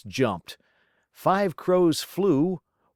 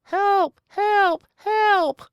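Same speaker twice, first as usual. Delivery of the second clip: high squeaky cries for help, from inside a nose; muffled tiny hollers; 4 dB louder, slower than usual. A narrator's reading. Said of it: "Richard's hollers were so muffled".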